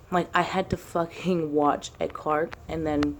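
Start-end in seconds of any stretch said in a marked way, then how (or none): none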